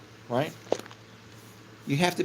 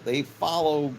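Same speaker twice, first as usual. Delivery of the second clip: ordinary talk, nothing marked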